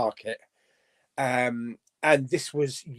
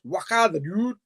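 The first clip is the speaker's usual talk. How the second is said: higher, crude Scottish impression; Scottish accent; 4 semitones higher than usual. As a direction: put on a rough Scottish accent. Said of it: "try to do a Scottish accent".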